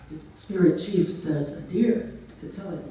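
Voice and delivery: usual speaking voice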